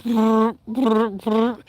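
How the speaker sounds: short repeated clipper buzzes; imitated clippers. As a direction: mimic shavers running over a head. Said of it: "He just went".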